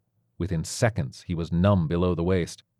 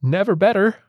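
strained, much higher forced cheer through pain; forced smile; 12 semitones higher, 5 dB louder than usual. A narrator's reading. Injured father to his son, forced smiling cheer. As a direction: grit out a brave cheerful lie, pitched way up. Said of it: "He forced himself to smile and said".

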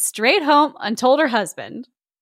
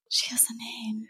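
hushed, soft whisper; awed whisper; 13 dB quieter than usual. Real, not acted. Host marvels that Susan has a name, awed whisper. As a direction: whisper it like a discovery. Awed at wife being named.

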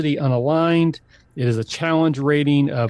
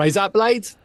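higher "Rise up, blades" in faked Australian; Australian accent; 6 semitones higher, slower than usual. Shown as a demonstration. Repeating the trick phrase, terrible American Australian accent. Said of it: "get you to the Australian".

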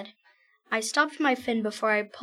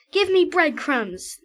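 demand; 5 semitones higher, 6 dB louder than usual. Acted. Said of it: "said the other one".

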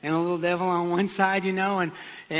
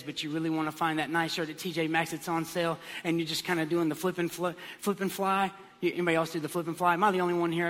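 usual speaking voice

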